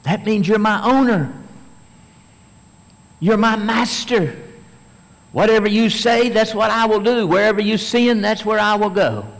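loud, high fervent vow; preaching at full force. Acted. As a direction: declare surrender with full fire. Fervent sermon climax.

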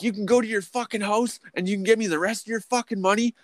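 high, strained demand; retold threat. Worker ordering the boss to fetch the money, high demand. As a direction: replay the threat hot.

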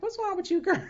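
high-pitched imitation of a checking-in pastor; pastor's playful concern. Pastor checks on stressed speaker in passing, mock concern. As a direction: put on a high, teasing "what's up" voice.

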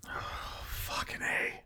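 unvoiced dismay under the breath; hushed swear at bad news; whispered curse. Real, not acted. Player mutters a curse as combat starts, whispered.

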